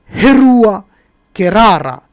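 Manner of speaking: Herua Kerara, the N turned into a rolled R. Shown as a demonstration. Teaching the Ruu and Rari dialect, R instead of N. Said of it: "C'est le N ... qui se transforme en Ra".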